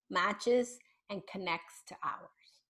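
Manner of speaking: slow, soft whisper. Shown as a demonstration. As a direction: hush down to calm the child's nervous system. Calming voice demonstration.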